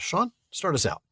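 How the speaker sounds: ordinary talk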